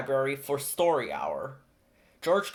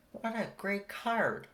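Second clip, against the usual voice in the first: librarian; 6 semitones higher, 4 dB quieter, slower than usual. A narrator's reading. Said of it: "the librarian said".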